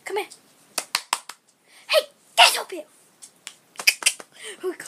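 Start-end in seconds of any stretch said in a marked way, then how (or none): 1.9-2.8 loud call to the dog. Real, not acted. Calling the dog to jump up, loud.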